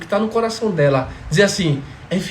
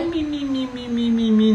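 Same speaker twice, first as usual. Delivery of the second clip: mocking baby talk. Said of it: "não".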